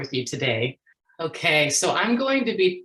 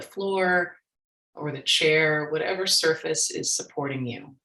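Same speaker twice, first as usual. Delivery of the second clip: soft, unhurried meditation voice with long pauses; guided meditation; 5 dB quieter than usual. Real, not acted. Speaker leading workshop audience in mindfulness practice, guided meditation. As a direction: guide listeners gently into their breath, leaving space.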